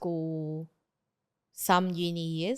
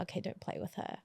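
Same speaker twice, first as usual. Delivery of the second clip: whispered; 15 dB quieter than usual. Retold or imitated. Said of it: "the other girls would be like".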